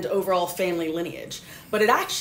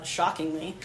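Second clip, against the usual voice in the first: dry, understated sarcasm; sarcastic; 2 semitones lower, 4 dB quieter than usual. Real, not acted. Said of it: "that was sarcasm for listeners".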